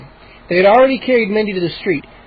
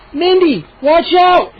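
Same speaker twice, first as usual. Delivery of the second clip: high, loud warning; warning shout; 10 semitones higher, 4 dB louder, slower than usual. Acted. Boy warning his sister of the gnomes. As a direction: shout the warning too late.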